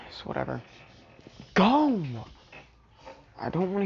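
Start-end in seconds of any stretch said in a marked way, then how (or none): 1.5-2.0 shout. Real, not acted. Shouting at others.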